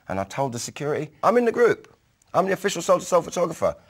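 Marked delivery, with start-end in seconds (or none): 1.2-3.7 brash North London Cockney, higher and louder, fast at the end; North London Cockney accent. Retold or imitated. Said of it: "put on my best North London Cockney accent".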